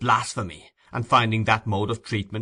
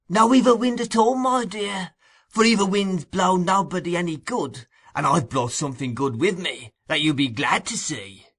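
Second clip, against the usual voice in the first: much higher, oily wheedling; 6 semitones higher than usual. A narrator's reading. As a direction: wheedle like the sly old fence.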